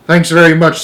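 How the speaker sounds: loud, high, irritated thanks; snapped retort. A narrator's reading. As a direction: throw the thanks back sharply. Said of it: "snapped the head of the".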